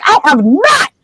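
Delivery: high, indignant protest, voice jumping up; furious denial. Retold or imitated. Harry denying signed photos to Hagrid, furious and high. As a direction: bristle and deny it hotly. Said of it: "immediately he's furious".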